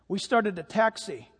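ordinary talk, nothing marked

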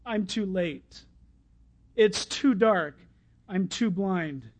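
slow, sinking, hopeless lament; despairing. Acted. Beggar left behind as Jesus goes on, slow despair. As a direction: let each short phrase drag, giving up. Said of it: "it was a cry of despair".

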